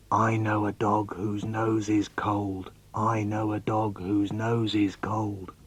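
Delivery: low, soft, slow chant; quiet, slow, low poetic refrain; hushed poem refrain. Acted. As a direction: intone it softly on a level pitch.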